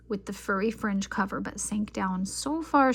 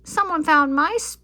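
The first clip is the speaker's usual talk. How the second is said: much higher, louder squeak; high squeaky voice; 8 semitones higher, 9 dB louder than usual. A narrator's reading. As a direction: sputter it as little baby bear. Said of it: "in her high, squeaky voice".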